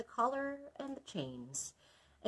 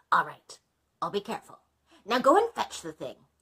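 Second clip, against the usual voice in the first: a dragon, louder and eager; 9 dB louder than usual. Acted. Dragon agreeing to the blacksmith, louder dragon voice. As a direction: boom it out like a big eager dragon.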